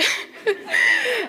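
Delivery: short bright laugh; high laugh. Real, not acted. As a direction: laugh at yourself.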